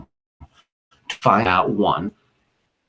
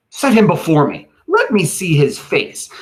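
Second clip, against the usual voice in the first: high, forceful ruler's order; raised, declaimed stage order; commanding Caesar; 5 semitones higher, 5 dB louder than usual. Acted. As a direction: command it like a Roman leader. Acting out Caesar's orders from play.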